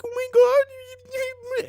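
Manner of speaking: high drawn-out wail; exaggerated crying, loud and slow; mock crying. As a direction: imitate sobbing in a squeaky, stretched voice. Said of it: "She's, like, crying".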